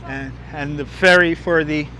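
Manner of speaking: ordinary talk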